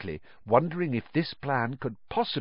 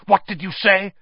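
higher, louder sharp demand; spat out; 7 semitones higher, 6 dB louder than usual. A narrator's reading. Malfoy demands the elf repeat it, spitting angrily. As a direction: spit it back in disbelief. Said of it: "spat Mister Malfoy".

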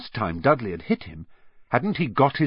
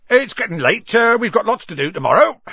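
high, loud changing of subject; loudly; 10 semitones higher, 7 dB louder than usual. Acted. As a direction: say it loudly to cut him off. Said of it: "said Hagrid loudly".

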